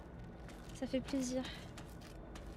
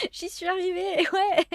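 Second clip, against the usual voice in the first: laughing; 7 semitones higher, 9 dB louder, slower than usual. Real, not acted. Relieved after escaping the monster.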